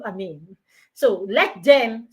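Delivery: ordinary delivery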